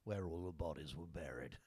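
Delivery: lightly voiced uncle's low growl; Unc's growl. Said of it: "as Unc would growl".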